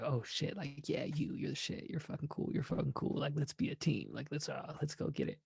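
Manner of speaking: soft, under-the-breath fanboying; hushed admiration. Retold or imitated. Telling a cool stranger he's the shit, in a hushed voice. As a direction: murmur it like you're starstruck.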